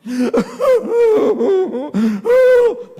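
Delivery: loud, high fake bawling; exaggerated acted weeping; mock wailing sobs. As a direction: bawl noisily as if crying mid-prayer. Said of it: "sholat nangis".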